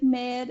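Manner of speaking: "mad" in her own lisp; lisped word. Shown as a demonstration. Guest showing which words reveal her lisp. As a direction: say "mad" as she always would. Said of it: "when you say things like".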